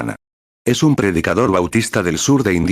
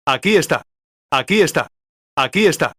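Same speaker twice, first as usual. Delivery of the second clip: high-pitched cry pointing someone out; mock calling out; 9 semitones higher than usual. Acted cry pointing out the preacher. (exclamación aguda imitando a quien delata)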